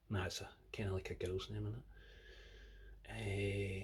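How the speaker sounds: whispered under the breath; a quick whisper, half to yourself; whispered aside. Real, not acted.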